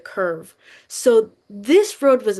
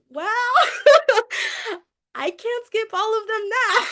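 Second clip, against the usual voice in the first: high, laughing disbelief; giggling, pitched way up; 11 semitones higher than usual. Retold or imitated. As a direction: laugh through the line at your own bad luck. Laughing at her own dilemma.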